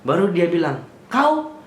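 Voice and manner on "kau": mock cop barking one word, pitched way up; a retold officer's sharp challenge; harsh police snap. Officer rounding on a young driver, sharp high mock snap.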